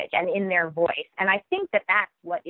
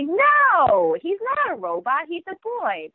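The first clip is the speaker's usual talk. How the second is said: put-on higher, loud chorus of children correcting; kids answering; 9 semitones higher, 4 dB louder, slower than usual. Children shouting back at the reader, high and loud. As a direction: call it out like a room of four-year-olds. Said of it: "And they say".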